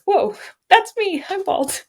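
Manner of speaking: high, loud startled gasp of a line. Retold or imitated. Startled by own bald reflection.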